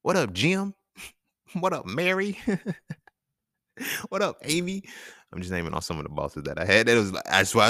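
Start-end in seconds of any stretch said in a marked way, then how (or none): 0.0-4.8 high-pitched, playful hailing; casual greeting. Retold or imitated. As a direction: greet each boss high, with chuckles. Acts out greeting former bosses.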